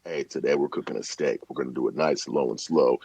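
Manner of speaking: very soft, low whispery voice-over; hushed deep voice. Shown as a demonstration. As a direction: keep it deep and quiet, as if a child naps nearby. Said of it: "instead of yelling, I'd be like".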